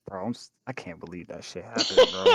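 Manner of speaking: low, hushed disbelief; soft, low acted grumble; muttering in helmet. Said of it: "inside his helmet, he's just".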